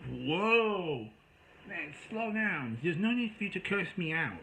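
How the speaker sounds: high, stretched-out "whoa"; retold startled protest. Telling the caller to stop cursing, high startled protest. Retelling protest at being cursed out.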